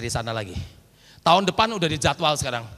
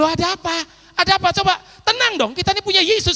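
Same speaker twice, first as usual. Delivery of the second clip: urgent reassurance; 12 semitones higher, 4 dB louder than usual. Retold or imitated. Preacher urges caller to calm.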